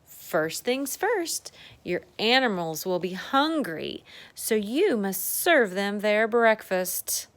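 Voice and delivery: put-on slow, deliberate read-aloud to a child; unhurried bedtime story voice; storybook reading. A narrator's reading. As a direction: read it out like a picture book. Reading the book text aloud.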